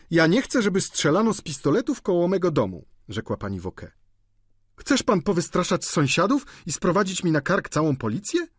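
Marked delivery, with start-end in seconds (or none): 0.1-2.9 higher, louder protest; indignant landlady. A narrator's reading. Landlady Wauquez forbidding shooting near her house, indignant. 4.8-8.5 higher, louder protest; indignant landlady. A narrator's reading. Landlady Wauquez forbidding shooting near her house, indignant.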